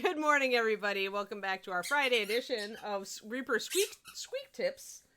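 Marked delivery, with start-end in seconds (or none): 3.6-5.0 squeaky title. Acted. Show title, dog named squeaky.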